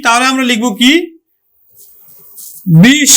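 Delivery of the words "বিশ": বিশ as one isolated, louder syllable; teacher's demonstration of বিশ; phonetic sounding-out. Shown as a demonstration. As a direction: sound out বিশ with a long closing sh. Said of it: "যদি এটাকে উচ্চারণ".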